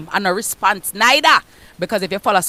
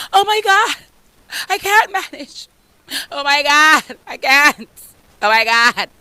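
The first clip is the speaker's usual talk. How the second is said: high, hammed-up helpless wail; mock damsel panic; 3 semitones higher than usual. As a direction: play the damsel who can't cope. Mocking a damsel in distress.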